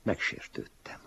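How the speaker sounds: ordinary delivery, nothing marked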